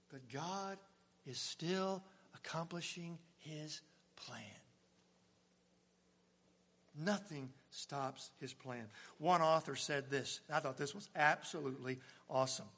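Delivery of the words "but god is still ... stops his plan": hushed pulpit turn. Acted. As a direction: drop to a secret hush. Drops to hushed contrast.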